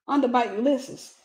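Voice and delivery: normal talking voice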